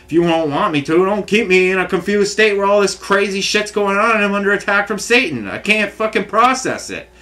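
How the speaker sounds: high-pitched exasperated outburst to God; angry, overwhelmed plea. Retold or imitated. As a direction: plead angrily, voice pushed up high. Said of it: "I say these stupid things out of anger".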